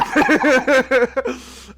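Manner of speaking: loud, high burst of laughter; real loud laugh. Real, not acted. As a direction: burst out laughing mid-story. Laughs while telling flirty story.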